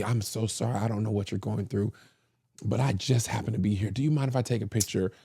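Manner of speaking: quiet, hushed, apologetic ask; soft sympathetic stranger. Retold or imitated. Stranger at the airport, quietly asking a grieving man for a photo. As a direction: coax gently, sorry to intrude.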